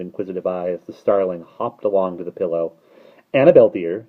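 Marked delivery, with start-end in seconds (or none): 3.3-4.1 husky coaxing, loud; wheedling. A narrator's reading. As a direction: coax her sweetly. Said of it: "husky, wheedling voice".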